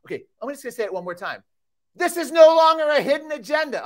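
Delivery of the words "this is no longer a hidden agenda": high, loud scream of alarm. Real, not acted. Speaker warning the audience, screaming. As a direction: cry it out at the top of the voice. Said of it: "I'm sorry for screaming".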